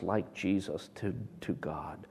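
hushed whisper. Real, not acted. Preacher whispering God's name to the congregation. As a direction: drop to a whisper on God.

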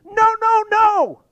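shrill, loud triple no; a piercing, desperate cry of no; screaming no. Retold or imitated. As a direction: scream it out in horror. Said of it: "he is literally screaming".

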